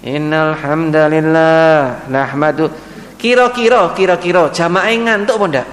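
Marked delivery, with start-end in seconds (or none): 0.0-2.7 slow, lower, monotonous Friday preacher; mock a boring khutbah opening; droning sleepy sermon. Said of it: "khutbah Jumatnya itu begini".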